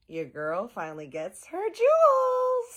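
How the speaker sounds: gleeful sing-song. Pretend. Teasing episode highlight.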